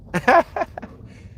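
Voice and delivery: short high giggle; real amused laugh. Real, not acted. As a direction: giggle at himself. Laughing at pronunciation.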